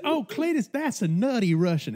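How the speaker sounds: high-pitched mimicked bartender; bartender impression. Bartender explaining the drink to Cletus, mimicked high.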